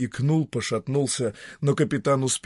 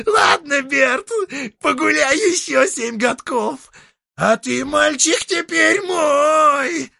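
high, creaky devil voice; a creaky, mocking old devil; 8 dB louder, slower than usual. Devil Dieter claiming soul.

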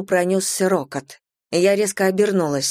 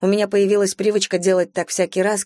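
ordinary delivery, nothing marked

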